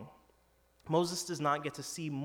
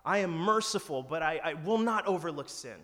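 booming proclamation, louder and higher; God proclaiming; 4 semitones higher, 7 dB louder than usual. Acted. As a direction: announce it like God declaring his name. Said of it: "he proclaimed his name".

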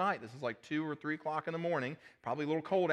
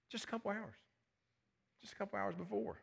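hushed aside; 6 semitones higher, 8 dB quieter than usual. Real, not acted. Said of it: "preacher's hushed aside".

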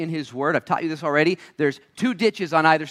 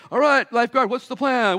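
mock casual question; 9 semitones higher, 4 dB louder than usual. Drowning man quizzes lifeguard.

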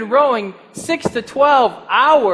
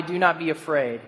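gentle reassurance; 6 semitones lower, 7 dB quieter than usual. Retold or imitated. Jesus calming frightened disciples.

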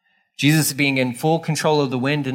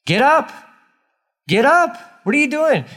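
much higher, louder frantic yell; panicked yell; 12 semitones higher, 4 dB louder than usual. Retold or imitated. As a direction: shout at a sleeping man in a storm. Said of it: "They're dying, and he doesn't care".

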